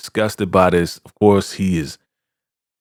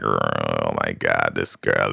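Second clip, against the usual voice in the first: much lower and slow, under her breath; a deep, slow groan of disbelief; disbelieving grumble; 4 dB quieter, slower than usual. Retold or imitated. Elder Mother grumbling in disbelief.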